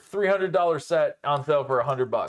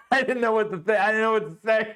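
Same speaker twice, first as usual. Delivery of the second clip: laughing; 7 semitones higher, faster than usual. Real, not acted. Laughing at wife's bad shot.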